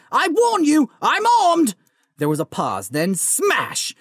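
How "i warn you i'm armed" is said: high, loud frightened shout; shouted threat. A narrator's reading. Vernon shouting a threat at the intruder. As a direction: yell through the door to scare him off. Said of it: "he shouted".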